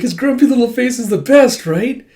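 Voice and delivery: high, loud eager voice; gushing fan. Retold or imitated. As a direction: share the love, wanting agreement. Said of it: "It's like".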